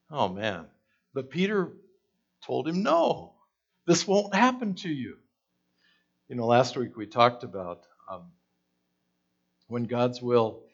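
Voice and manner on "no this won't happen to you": much higher, loud insistent no; Peter's high protest. Retold or imitated. Peter telling Jesus it won't happen, high and insistent. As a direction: plead against it, alarmed.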